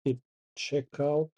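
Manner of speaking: usual speaking voice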